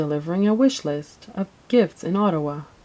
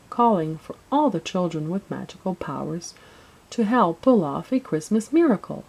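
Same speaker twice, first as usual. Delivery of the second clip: ordinary delivery, nothing marked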